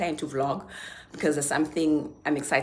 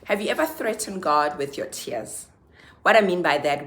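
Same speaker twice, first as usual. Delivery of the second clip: ordinary talk, nothing marked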